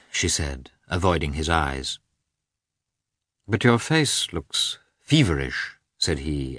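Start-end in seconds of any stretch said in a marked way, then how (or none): 3.5-5.8 slow line with feverish weighted and drawn out; stress on feverish. A narrator's reading. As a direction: lean hard on the word feverish. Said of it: "emphasizing the word feverish".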